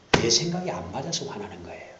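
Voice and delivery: ordinary delivery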